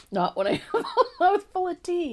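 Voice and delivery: laughing through the words. Real, not acted. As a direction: giggle while talking. Laughing after a tease.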